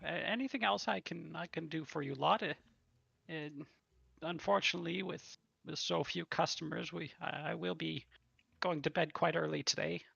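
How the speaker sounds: apologetic tavern waitress. Acted. Waitress speaks to party.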